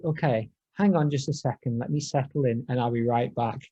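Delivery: ordinary talk, nothing marked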